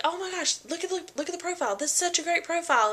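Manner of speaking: high, quick excited gush; gushing doctor. Retold or imitated. Doctor gushing over the baby's ultrasound profile, high and excited. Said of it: "freaking out about it when he saw it".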